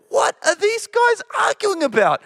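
can't believe what you're hearing; incredulous. Retold or imitated. Incredulous inner thought about disciples.

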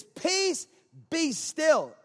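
high, ringing command; commanding the storm. Acted. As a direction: proclaim it with authority over the wind.

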